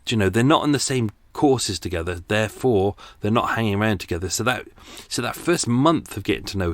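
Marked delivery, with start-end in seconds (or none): none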